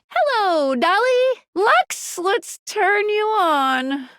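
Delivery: high-pitched, drawn-out showy call. Acted. Guide wakes Dolly and Lux, showy calling out. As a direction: call them up high, stretching the last words.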